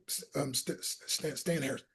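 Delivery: put-on nervous teen stammering his name; shy stammer. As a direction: mumble your name timidly.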